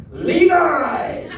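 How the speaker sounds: high, loud call from behind; strange voice. Retold or imitated. Stranger on a bench calling out to him, strange high holler. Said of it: "is this strange voice".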